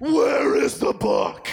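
a slow, high strained wail; slow high cry. Pretend. Cohost parodying Batman with a slow high wail.

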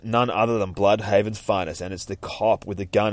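ordinary delivery, nothing marked

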